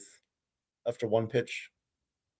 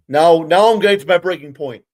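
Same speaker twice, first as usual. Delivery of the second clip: louder, boiling-over frustration; real anger; 3 semitones higher, 8 dB louder than usual. Real, not acted. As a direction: at the end of his rope. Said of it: "I'm getting really pissed off now".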